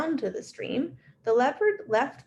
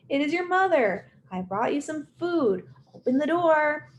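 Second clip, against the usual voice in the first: higher sweet womanly call; a convincing mother's call; 7 semitones higher than usual. Acted. Leopard after the special drink imitating the mother, higher womanly voice. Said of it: "in a high, womanly voice".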